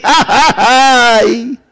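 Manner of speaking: acted gloating "ja, ja, ja"; mocking laugh trailing into a drawn-out "ay"; devil's laugh. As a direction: imitate Satan laughing at a sinner. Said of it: "levanta y se ríe".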